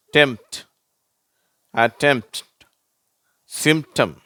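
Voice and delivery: டெம்ப்ட், அட்டெம்ப்ட், சிம்டம் as m-t, no p; spaced repeat of the model words; silent P demo. Shown as a demonstration. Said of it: "பீன்ற உச்சரிப்பு வரதில்லை".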